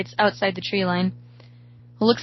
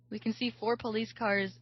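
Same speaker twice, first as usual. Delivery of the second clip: ordinary delivery, nothing marked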